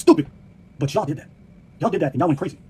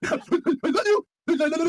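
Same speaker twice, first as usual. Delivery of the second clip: mock lovesick voice; 8 semitones higher than usual. Mock lovers' voice.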